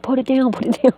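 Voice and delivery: high urgent repeated order; mock warning shout. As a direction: bark the order at her. Others yelling at range.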